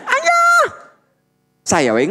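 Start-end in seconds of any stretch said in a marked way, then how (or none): 0.1-0.9 high, loud call across the floor; calling out loudly. Retold or imitated. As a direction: yell for dad from afar. Calling husband in the mall.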